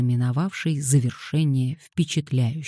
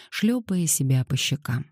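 plain reading voice

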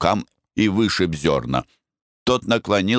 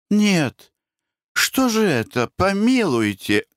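put-on elderly woman; 14 semitones higher than usual. A narrator's reading. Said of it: "рассуждает какая-то пожилая женщина".

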